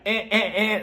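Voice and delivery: high, repeated wrong-answer buzzer noise; game-show style rejection sound; imitated buzzer. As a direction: buzz someone out mockingly. Producers cutting her off like buzzer.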